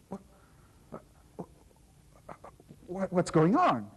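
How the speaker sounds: stammering, then a loud bewildered burst; stunned stammer. Acted. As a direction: trip over the words, then blurt it out. Knight stunned by the transformation.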